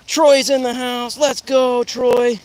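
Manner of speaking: loud, high, slow announcer call; hype announcement. Real, not acted. Calling out to Troy, loud and high like an announcer. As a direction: boom it out like a ring announcer.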